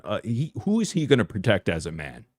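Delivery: usual speaking voice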